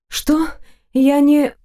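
high, startled half-question; lightly voiced shocked girl; startled gasp. A narrator's reading. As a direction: catch her breath in sudden alarm. Heroine startled, cut off.

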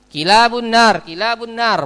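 loud, high call of kilabun nar. Retold or imitated. Quoting a harsh call about raised voices. (suara keras dan tinggi)

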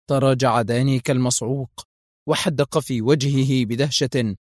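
plain reading voice

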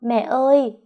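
put-on high, loud child's cry. Little daughter cries out to her mother. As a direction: call out like a sobbing little girl. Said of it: "vừa khóc vừa gọi".

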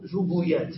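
زبويات with a u after both z and b; disallowed plural form. Shown as a demonstration. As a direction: sound out زبويات as zu-bu-yaat. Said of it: "ما نقول زبويات".

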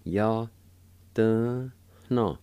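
held syllables with pauses between; syllable-by-syllable demo. Shown as a demonstration. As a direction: break the word into slow, clear pieces. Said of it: "Second part".

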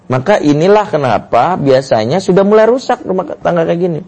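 normal talking voice

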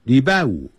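ordinary delivery

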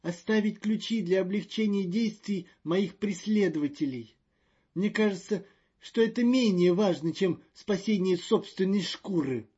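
high, loud snapping retort. A narrator's reading. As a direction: snap back like a cornered rat. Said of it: "окрысился Маньян".

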